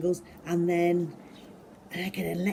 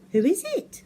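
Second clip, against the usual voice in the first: high, louder questioning voice; puzzled question; 13 semitones higher, 7 dB louder than usual. Acted. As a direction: ask who it is, baffled and high. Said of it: "I asked".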